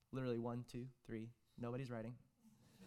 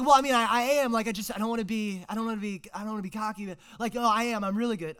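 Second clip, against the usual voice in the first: put-on cocky teen; 7 semitones higher, 12 dB louder, faster than usual. Kid brags at soccer tryout.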